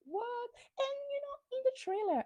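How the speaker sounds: high, disbelieving like what; shocked squeal of disbelief; incredulous. Real, not acted. Vlogger reacting to Raquel's cheating, incredulous.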